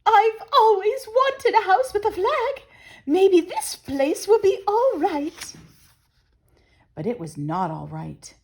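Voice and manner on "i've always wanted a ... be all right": high, louder delighted bird voice; high hopeful chirpy bird voice; pleased Missus Bird. Acted. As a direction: gush over the flag. Missus Bird likes the flag.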